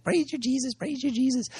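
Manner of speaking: high, soft imitation of the ecstatic intercessor; mock praise. Intercessor in service, imitated high and soft. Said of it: "she's like".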